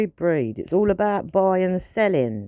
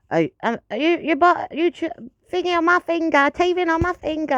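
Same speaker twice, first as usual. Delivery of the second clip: much higher, cooing puppy-parent voice; doting pet talk; 8 semitones higher than usual. Retold or imitated. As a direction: coo at the teething puppy. Voicing herself scolding the puppy.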